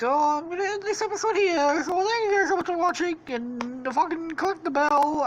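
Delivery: high, quick mock YouTuber sign-off; Stampy impression. YouTuber thanking viewers for watching, high mock British voice. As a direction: chirp it out in a high put-on British voice. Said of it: "And then he's like".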